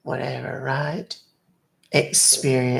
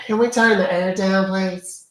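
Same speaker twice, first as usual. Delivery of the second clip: higher, louder call to the driver; 6 semitones higher, 4 dB louder than usual. Real, not acted. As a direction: raise your voice to someone up front. Calling out to the driver.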